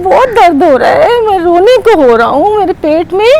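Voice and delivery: whining panda. Acted. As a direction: near tears, tell the crow. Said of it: "तो पांडे ने क्या कहा".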